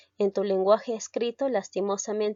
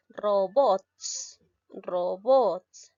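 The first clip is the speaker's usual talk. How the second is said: "robots" as ro-BOTS; careful demo of "robots", final syllable stressed; stress on last syllable; much slower than usual. Shown as a demonstration. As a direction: lean hard on BOTS in robots. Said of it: "La mayor fuerza de voz la tiene la sílaba bots".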